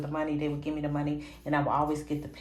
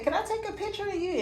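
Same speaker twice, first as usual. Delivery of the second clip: put-on much higher, fast eager kid's plea; eager child asking; faster than usual. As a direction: rush the question out like an excited young fan. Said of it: "and I said".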